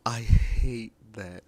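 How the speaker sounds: loud, slow and fed up; frustrated outburst. Real, not acted. Vlogger venting about having to use a voice filter, loud and frustrated. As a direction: blurt it out, really annoyed.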